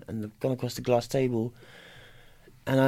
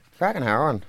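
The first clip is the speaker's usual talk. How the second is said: put-on Northern Irish accent; 2 semitones higher than usual. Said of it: "that in a Northern Irish accent for years".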